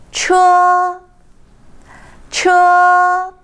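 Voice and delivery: "车" high, level and sustained; first tone. Shown as a demonstration. Teacher drilling the word for car, high flat first tone. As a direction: hold "车" high with no pitch change. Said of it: "The first tone".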